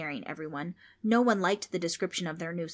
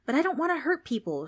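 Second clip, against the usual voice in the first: put-on pleading girl; 9 semitones higher, 4 dB louder than usual. Trix pleads.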